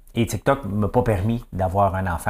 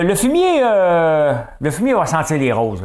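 very high, loud mock politician; hammed-up squeal of a quote, easing down; Denis Coderre; 8 semitones higher, 6 dB louder, slower than usual. Host mocking a politician's attempt at compliments, high loud imitation. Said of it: "Il dit, euh".

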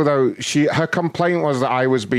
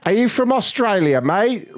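high-pitched imitation British accent; cartoonish British accent, pitched way up; mock British accent; 12 semitones higher, 3 dB louder than usual. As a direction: tease an Australian in a put-on British accent. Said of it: "in a British accent".

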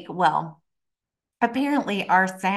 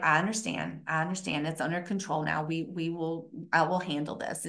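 soft, low reassurance; cool, unfazed; 4 semitones lower, 8 dB quieter, faster than usual. Retold or imitated. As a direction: calmly take control, keeping it hushed. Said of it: "my coolness".

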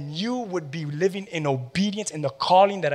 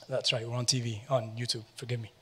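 much softer, half-whispered apology; sheepish aside; 4 semitones lower, 11 dB quieter than usual. Real, not acted. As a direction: mutter it off to the side.

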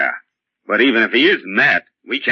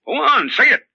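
much higher, shouted demand; pressing shout. Acted. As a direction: force him to say it. Interrogator pressing the patient.

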